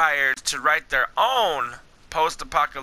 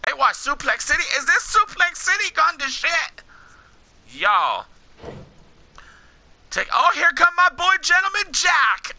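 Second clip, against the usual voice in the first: ordinary talk, nothing marked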